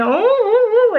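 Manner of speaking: high, loud rising and falling oohs; mock-scandalised oohing. Reader reacting to a nude picture, mock-scandalised oohs. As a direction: coo it high and loud, cheekily.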